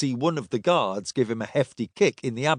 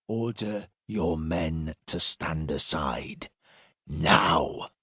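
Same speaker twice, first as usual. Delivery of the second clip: low, slow order; menacing command; 7 semitones lower, slower than usual. A narrator's reading. Silas commanding the frightened centurion, low menace. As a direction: give the order low and measured.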